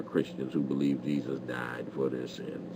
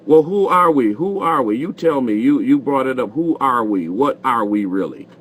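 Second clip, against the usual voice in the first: much higher and louder, pressing hard; exasperated demand; 8 semitones higher, 10 dB louder than usual. Real, not acted. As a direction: demand an answer, fed up. Host demands answer from caller.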